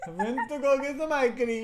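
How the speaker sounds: laughing copy of an Italian ice cream line; mock Italian accent. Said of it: "I'm just giving Italian".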